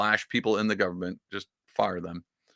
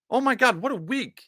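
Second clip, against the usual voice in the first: 1 high thrilled; 12 semitones higher than usual. Real, not acted. Overjoyed about the week.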